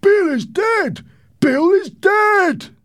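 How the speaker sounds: very loud, high, drawn-out yell; high-pitched henchman calling out at full volume; shouted news. Acted. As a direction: yell it down from a window. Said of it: "a man shouted from the window".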